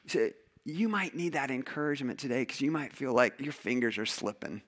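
usual speaking voice

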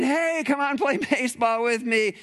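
high, bright pleading invite; a child begging to play; eager kid. Retold or imitated. Kid asking parent to play baseball, eager. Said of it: "your kids are saying".

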